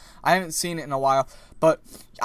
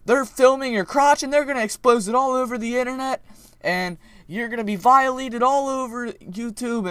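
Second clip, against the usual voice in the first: high, very loud hysterical cry; mock screaming lady; 8 semitones higher, 6 dB louder than usual. Imitating the lady shouting at the lifeguard. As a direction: panic like a frantic old lady. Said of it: "telling lifeguard".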